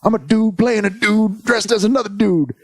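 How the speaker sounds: high put-on character voice; movie quote impression. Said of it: "It's like".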